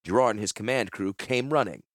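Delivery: ordinary delivery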